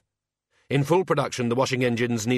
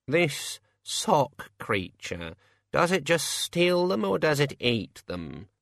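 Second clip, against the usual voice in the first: very slow, higher musing voice; ponderous Senior Wrangler; 4 semitones higher, much slower than usual. Acted. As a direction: puzzle it out at a crawl.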